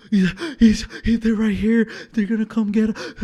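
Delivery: gasping, high, loud panicked sobs; imitated crying. Terrified friend pleading with sleeper at night, crying. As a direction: imitate stammering through frightened tears. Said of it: "he's fucking crying, dude".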